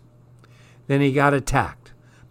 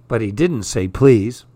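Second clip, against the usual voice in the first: ordinary delivery, nothing marked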